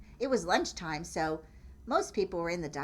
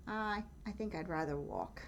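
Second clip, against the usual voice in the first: Horse's timid thought; 8 dB quieter than usual. Acted. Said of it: "what he thought".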